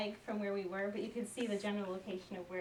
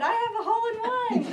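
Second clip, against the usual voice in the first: much higher and louder, laughing with joy; excited, laughing; 12 semitones higher, 10 dB louder, much faster than usual. Retold or imitated. Golfing dad announcing his hole-in-one to his family, laughing with joy. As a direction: crow it through a laugh. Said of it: "he's so excited".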